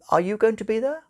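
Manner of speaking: nonchalant angel. Acted. Angel casually asking the demon, a touch nonchalant. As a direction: toss it off, mildly casual. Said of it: "asked the angel nonchalantly".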